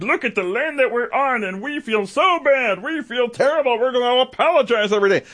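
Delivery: high-pitched mocking lament; mock Democrat apologizing. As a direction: parody hand-wringing politicians in a put-on high voice. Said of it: "where they're just like".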